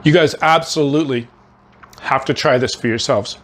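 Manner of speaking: talking through a mouthful; chewing while speaking; mouth full. Real, not acted. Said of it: "forgive me for talking with my mouth full".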